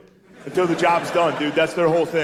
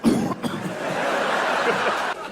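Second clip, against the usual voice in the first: drawn-out mock coughing fit; imitated coughing; 3 dB quieter than usual. Man coughing during sex, imitated coughing fit. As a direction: act out a breathless cough. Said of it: "Coughing during sex is funny".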